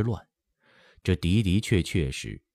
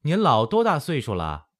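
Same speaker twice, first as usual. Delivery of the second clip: high, raised-voice question; pitched up to get through to poor hearing; asking loudly; 8 semitones higher, 3 dB louder than usual. A narrator's reading. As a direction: call it out to a deaf elder. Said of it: "慧儿又大声问道".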